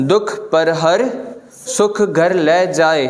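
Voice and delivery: सुख घर ले जाए said slowly, stress on सुख; slow chant with even weight. Shown as a demonstration. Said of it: "दिलां लॻल आहे". (धीरे उचारण)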